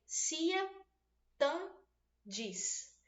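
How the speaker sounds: syllables counted backwards, "cia", "tan", "dis", each alone. Shown as a demonstration. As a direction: separate "cia", "tan", "dis" with clear pauses. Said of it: "da direita para a esquerda".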